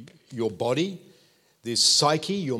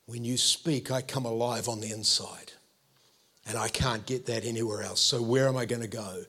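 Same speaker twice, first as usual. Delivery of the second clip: lower, softer, heartfelt admission; sincere confession; 4 semitones lower, 4 dB quieter than usual. Acted. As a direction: drop the protest and confess quietly. Peter turns to sincere confession.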